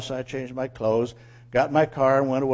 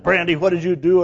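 loud, raised scolding; angry yell; 4 semitones higher, 9 dB louder than usual. Retold or imitated. Owner holds dog by the dirt, yelling angrily. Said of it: "I yelled at her".

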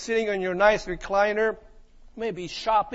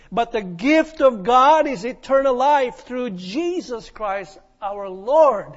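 solemn scripture reading; 6 dB louder, slower than usual. Acted. Reads scripture with weight.

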